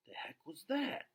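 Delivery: a touch of George's demanding question; lightly voiced dad, puzzled; mild demand. George asks what just happened, a mild demand. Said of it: "George demands".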